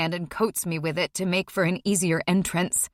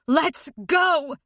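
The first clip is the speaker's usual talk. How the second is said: screaming; 5 semitones higher than usual. A narrator's reading. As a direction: shout it harder. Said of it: "she's screaming".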